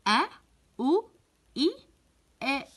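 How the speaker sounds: "أ، أو، إ" short and separate; isolated hamza vowels "أ، أو، إ", pauses between; vowel sound drill. Shown as a demonstration. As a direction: sound out each of "أ، أو، إ" alone. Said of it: "بالشكل المناسب".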